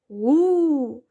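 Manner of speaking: drawn-out, rising monster howl; monster's roar. A narrator's reading. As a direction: roar out a long wordless 'oo'. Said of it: "ревонуло страхіття ще раз".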